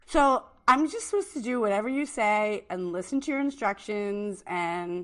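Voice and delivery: ordinary talk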